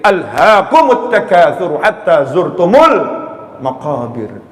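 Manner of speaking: slow, drawn-out sacred recitation; Quran recitation. Acted. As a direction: recite it slowly with long held syllables.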